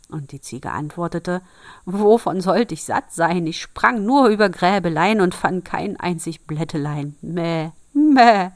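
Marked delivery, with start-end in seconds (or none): 1.8-8.5 loud, protesting goat voice; goat's indignant singsong complaint; bleating goat. Acted.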